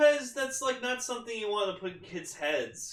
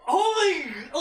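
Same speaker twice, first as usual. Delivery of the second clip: higher, louder gasp of shock; retold shock; 12 semitones higher, 9 dB louder than usual. Retelling her reaction to the episode, higher startled gasp. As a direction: react as if stunned by the scene. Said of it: "And I'm like".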